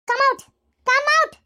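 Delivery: high, loud urgent calling; shouting. Acted. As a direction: yell at the closed door, urgent. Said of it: "ಯಾಕ್ ಹಂಗ್ ಹರ್ಕೊಂತ ಇದೀಯ?". (ತುರ್ತಾಗಿ ಜೋರಾಗಿ ಕೂಗುವುದು)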